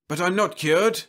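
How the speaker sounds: despair. Acted. Husband protests despairingly.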